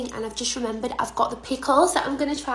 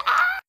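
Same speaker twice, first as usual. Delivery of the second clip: sudden very high squeal; high shriek; 4 dB louder than usual. Real, not acted. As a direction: let out a startled squeal. Reacts to the pickle.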